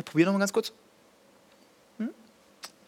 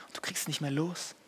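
whispered; 11 dB quieter than usual. Acted. Whispered addiction line.

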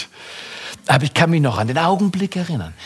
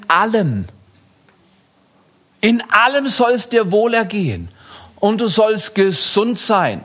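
high, urgent sermon voice; rally them to speak the Word; fiery preaching; 7 semitones higher than usual. Acted. Preacher urging the sick to declare God's Word, fiery and high.

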